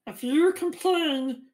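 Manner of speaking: slow, high, rising shout; irritated threat. Acted. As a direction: snap the warning slowly. GM voices irritated prisoner.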